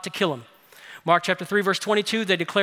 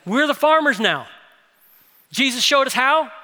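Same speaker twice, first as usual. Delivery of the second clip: higher, louder, driving sermon voice; forceful preaching; 3 semitones higher, 4 dB louder than usual. Acted. Pastor urging his church to sow the gospel, full-force preaching. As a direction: preach it out to the room with energy.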